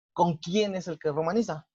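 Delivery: usual speaking voice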